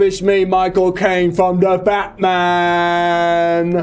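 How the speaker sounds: drawn-out, hammy attempt at a British accent; slow, stretched fake British introduction; bad British accent. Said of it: "What should I say in a British accent?".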